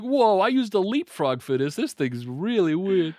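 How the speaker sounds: hammed-up astonished robber; baffled train robber. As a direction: play a clueless driver, loudly amazed. Acts out the baffled robber driver.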